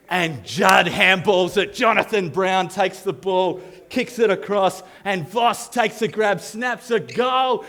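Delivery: high, drawn-out footy commentator call; imitating a kid's excited match commentary; sports commentary. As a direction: call the play, building in pitch to the goal. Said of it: "I would commentate myself through the game".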